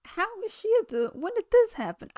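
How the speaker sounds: high-pitched, rushed disbelief; incredulous. Retold or imitated. Reacting to news of a billionaire singer, incredulous. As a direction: blurt it out high, cutting yourself off.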